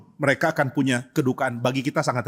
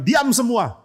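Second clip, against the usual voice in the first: raised, higher-pitched order; loud command; 6 semitones higher, much slower than usual. Acted. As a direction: silence the whole room. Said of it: "Dia teriak dengan keras". (perintah tegas bernada tinggi)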